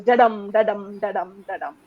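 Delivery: "da-dum" beats with the weight on DUM; heartbeat rhythm. Shown as a demonstration. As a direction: tap out "da-dum" like a steady heartbeat. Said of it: "basically the heartbeat rhythm".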